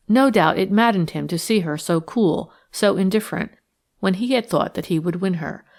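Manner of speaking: ordinary delivery, nothing marked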